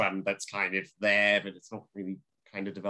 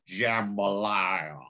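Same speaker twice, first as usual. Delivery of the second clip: very slow, stretched American southern drawl; much slower than usual. Retold or imitated. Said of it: "like, the southern drawl of a voice".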